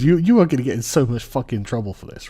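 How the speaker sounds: ordinary talk, nothing marked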